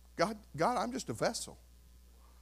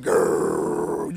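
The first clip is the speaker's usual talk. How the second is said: growl; 7 dB louder than usual. Pretend. Mocks the news dragging you down.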